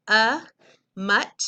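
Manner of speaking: A loud, then mutt with a short u; sounding out syllables. Shown as a demonstration. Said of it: "It's closed".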